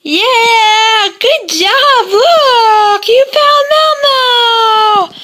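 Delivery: excited praise. Real, not acted. Praising baby for finding dog.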